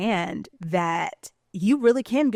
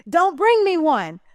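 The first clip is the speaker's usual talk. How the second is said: high, loud, slow dare; defiant challenge; 9 semitones higher, 6 dB louder, slower than usual. Retold or imitated. Woman challenging the universe every time, high defiant call.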